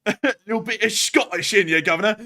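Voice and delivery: loud, high put-on Scottish accent; jokey Scottish accent, laughing into it; mock Scottish. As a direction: ham up a Scottish brogue. Echoes the Scottish joke.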